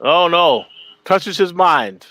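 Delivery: high, slow, drawn-out words; teasing mock dread. Real, not acted. Co-host reacting to the idea of him touching himself, teasing mock dread.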